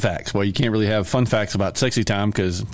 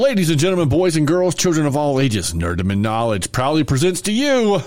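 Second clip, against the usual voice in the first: ringmaster; 5 semitones higher than usual. Pretend. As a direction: present it like a circus ringmaster. Ringmaster introduction of episode.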